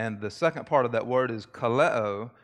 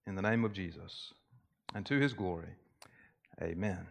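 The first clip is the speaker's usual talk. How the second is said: prayer; 2 semitones lower than usual. Acted. Pastor opening the sermon in prayer, hushed and unhurried. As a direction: pray humbly to God, pausing between phrases.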